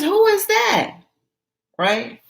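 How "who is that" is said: high curious Gina. Acted. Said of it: "So Gina says".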